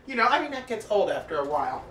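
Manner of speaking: ordinary talk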